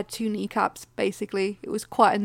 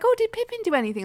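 curious question; 11 semitones higher, faster than usual. Retold or imitated. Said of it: "Freya was like".

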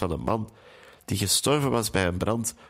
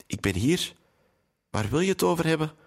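plain reading voice

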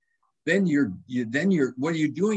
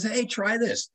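high-pitched Australian imitation; Australian accent; 9 semitones higher, slower than usual. Australian guy offers salt to a cramped runner, imitated Australian accent. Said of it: "says to me with his Australian, uh, accent".

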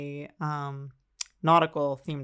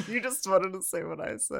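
talking through laughter; giggly, higher voice; laughing; 4 semitones higher, 4 dB louder than usual. Real, not acted. Laughing at repeated joke.

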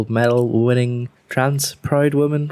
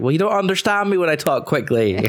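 higher-pitched comeback, protesting; retold indignant retort; 8 semitones higher than usual. Answering people who ask about the American twang, indignant. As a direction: push back in a raised pitch, mock-defensive.

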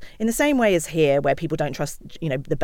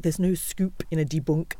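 put-on a light, half-hearted French accent, a bit softer; 5 dB quieter than usual. Quoting a French journalist to the host, light French accent. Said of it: "I can't do a French accent".

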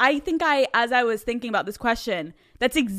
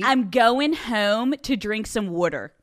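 Philly accent; slower than usual. Shown as a demonstration. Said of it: "what we call water".

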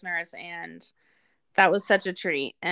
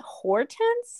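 incredulous. Retold or imitated. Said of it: "and I was like".

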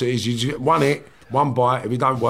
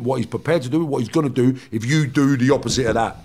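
usual speaking voice

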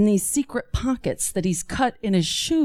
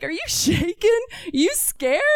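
taunting; 8 semitones higher than usual. Acted. Dad taunts frightened child.

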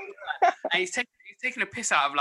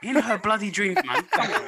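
mock outrage; 7 semitones higher, 6 dB louder than usual. Real, not acted. Denying love rumour, outraged.

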